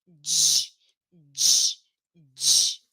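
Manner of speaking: teacher drill; voiced dj repeated. Shown as a demonstration. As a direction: di said as dji three times, with the throat buzzing. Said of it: "faz a força no pescoço".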